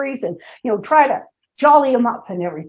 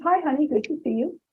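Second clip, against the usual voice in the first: soft, restrained hello; calm low-key greeting; 8 dB quieter, faster than usual. Retold or imitated. Wife greeting husband home, soft and restrained. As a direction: keep it short and quiet. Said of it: "and I says".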